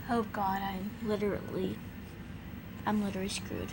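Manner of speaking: low, soft sigh of defeat; low quiet dread; muttered aside. Real, not acted. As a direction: mutter to yourself.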